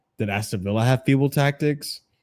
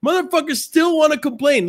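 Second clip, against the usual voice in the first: high, loud exasperated yell; high-pitched outraged outburst; loud rant; 5 dB louder than usual. Real, not acted. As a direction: shout the complaint, pitched way up. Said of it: "I know I'm clipping a lot".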